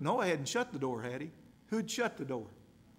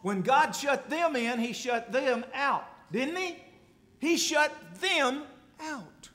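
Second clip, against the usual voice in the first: preaching climax; 9 semitones higher, 6 dB louder, slower than usual. Acted.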